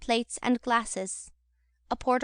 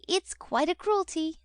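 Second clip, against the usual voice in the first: slow, higher doting Charlotte; 6 semitones higher than usual. A narrator's reading. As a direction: coo it slowly at him. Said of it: "said Charlotte".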